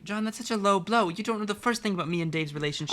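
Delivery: heated, stung retort; angry. Acted. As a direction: hit back hard, hurt and furious. Jade furious at John.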